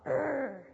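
short, loud guttural growl. Acted. Showing schoolchildren how the little man goes, loud growl. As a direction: let out a loud "urgh" of resistance. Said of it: "he does go".

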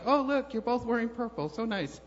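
high, bright mock chatter; a touch of cheerful small talk; chirpy remark. Man to lady in line, mock cheery compliment. Speaker quotes himself chatting.

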